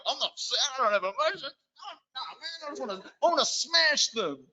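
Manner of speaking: high, hammed-up macho denial; mock tough guy. Teacher pretending to the boys he has no feelings, hammed-up macho voice. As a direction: play the emotionless bloke, overdone. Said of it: "I'm like".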